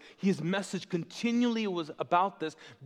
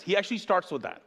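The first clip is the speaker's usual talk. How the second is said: usual speaking voice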